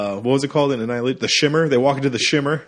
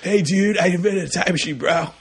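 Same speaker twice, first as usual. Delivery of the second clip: higher, breezy California surfer-bro brag; California surfer accent; 6 semitones higher than usual. Retold or imitated. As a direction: announce it like a stoked California surfer. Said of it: "California surfer accent".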